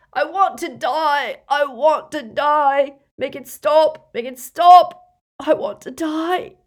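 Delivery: anguished scream, high and drawn out; full-voiced, slow wail of torment; Dumbledore. A narrator's reading. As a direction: scream in agony, begging for release. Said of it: "began to scream in more anguish than ever".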